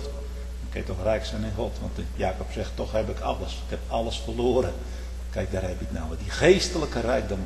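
preacher speaking quietly to the congregation; quiet sermon. Acted. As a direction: drop to a hush. Quiet intimate stretch.

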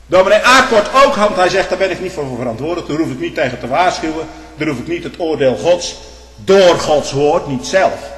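preacher mimicking Dominee Aak; mocking a minister. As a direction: parody the minister's excuses.

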